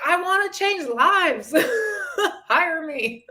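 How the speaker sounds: high, laughing, half-crying self-mockery; laughing at herself. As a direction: giggle through it at your old self. Mocking her younger coaching self.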